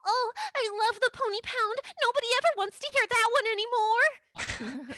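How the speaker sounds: high, fast, thrilled violinist voice; delighted violinist. Acted. Violinist gushing to the band, high and fast. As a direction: gush over the tune. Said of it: "the violinist pipes up and she says".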